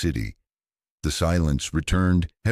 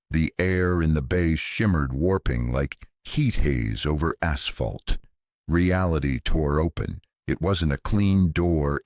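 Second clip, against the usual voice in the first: plain reading voice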